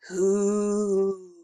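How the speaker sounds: drawn-out eerie call; icy monster. A narrator's reading. Monster calling from under the bed, icy voice. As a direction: stretch it out coldly, like a spook. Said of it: "an icy voice called".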